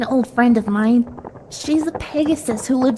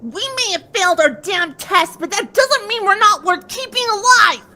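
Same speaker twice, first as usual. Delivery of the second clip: rising to a higher angry shout; shouted in rage; 7 semitones higher than usual. Acted. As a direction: flare up and throw it at them. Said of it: "Scootaloo shouted in a flash of rage".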